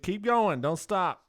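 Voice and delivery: usual speaking voice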